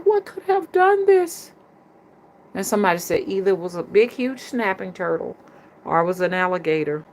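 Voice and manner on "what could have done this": very high, drawn-out put-on voice; hammed-up high-pitched bewilderment; mock shocked question. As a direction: act out alarm in a slow falsetto. Acts own shocked question.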